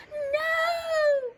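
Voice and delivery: high, loud wail; horrified cry. A narrator's reading. Crowd watches Big Bark fall, horrified. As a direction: cry out long in horror.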